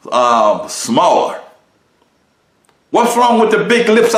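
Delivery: God pressing harder and harder, voice climbing high; God asking Rasul why he changed his body, building to a high loud cry; voice of God questioning. Retold or imitated. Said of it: "God is gonna say to you and me".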